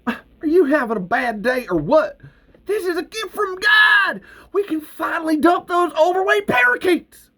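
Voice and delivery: very high, loud joyful yelling; excited shout. Acted. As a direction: shout the good news at Earl. Val shouting at Earl in joy.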